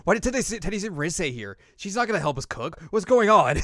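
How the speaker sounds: high, loud, rising panic; baffled and exasperated; flustered disbelief. Retold or imitated. Yu baffled that Teddy sent Rise, high exasperated.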